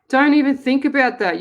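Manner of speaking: loud firm reprimand; sharp scolding. Real, not acted. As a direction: cut her fear off sharply. Scolding the worried caller.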